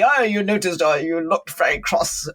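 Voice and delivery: judgmental, pointed observation; accusing tone. Shown as a demonstration. Pointing out someone's crossness, accusing tone. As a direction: say it like a mild accusation. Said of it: "it's the tone of voice you say it in".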